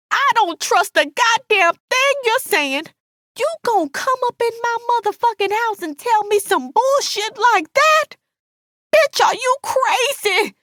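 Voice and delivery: very high-pitched furious rant; outraged, high-voiced tirade; enraged Alice. A narrator's reading. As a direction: berate her at the top of your range.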